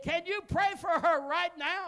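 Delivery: high, loud insistent asking; a woman asks the preacher loudly, half-shouted; loud request. Retold or imitated. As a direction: ask him loudly across the restaurant. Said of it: "You don't have to be so loud".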